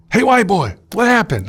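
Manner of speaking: the neighbour calling out from his cell; inmate calling out. Retold or imitated. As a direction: holler through the wall at him.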